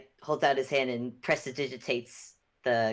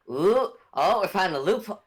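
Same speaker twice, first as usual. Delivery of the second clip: ordinary delivery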